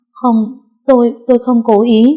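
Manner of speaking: startled, stammering denial; panicked. A narrator's reading. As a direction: blurt it out in shock. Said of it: "hốt hoảng nói". (hốt hoảng lắp bắp)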